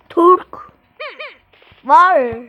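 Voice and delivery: usual speaking voice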